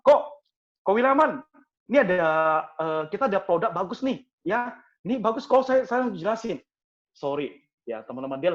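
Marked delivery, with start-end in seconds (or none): 0.1-6.6 high, loud eager selling at once; pushy sales pitch. Retold or imitated. Salesman barging in to sell insurance, eager and high. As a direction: rush in to push the product.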